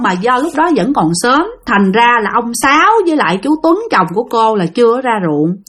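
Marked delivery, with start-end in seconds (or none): none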